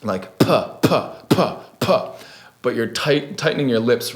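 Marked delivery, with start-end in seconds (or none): 0.4-2.1 "Puh" as short, separate plosive bursts, higher pitched; popped P sound. Shown as a demonstration. Tutor demonstrating the P sound for beatboxing, popped "puh". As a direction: pop each "puh" off the lips, isolated and percussive. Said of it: "You're basically just saying P".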